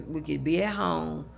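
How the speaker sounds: ordinary talk, nothing marked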